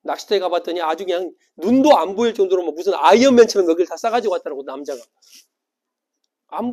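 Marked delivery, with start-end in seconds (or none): none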